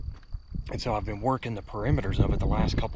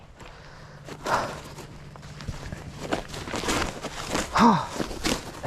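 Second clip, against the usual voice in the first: heavy relieved exhale; 10 semitones higher than usual. Real, not acted. After the kill.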